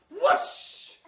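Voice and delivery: high, loud spell whoosh; magic whoosh. A narrator's reading. Storyteller casting a spell for children, loud high whoosh. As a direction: let the spell burst out high. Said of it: "she cast a spell and she went".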